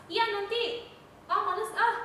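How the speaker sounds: put-on a kid snapping at a parent, grudging and lazy; lazy snapping reply. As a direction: play a sulky kid snapping back. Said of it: "jawabnya bentak-bentak, jawabnya males-males".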